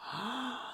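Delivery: soft, high, sudden intake of breath; soft gasp. Acted. Storyteller reaching the sight of Belle, soft acted gasp. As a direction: gasp lightly in wonder. Narrator gasps at the next vision.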